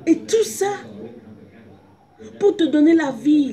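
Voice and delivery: high, loud impassioned preaching. Acted. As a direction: cry it out to the church. Preaching at full force.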